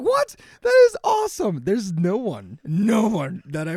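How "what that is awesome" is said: much higher and louder, thrilled; delighted surprise. Retold or imitated. Congratulating his friend on first place, overjoyed and surprised. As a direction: gasp out your joy for your buddy. Friend reacting to news of winning.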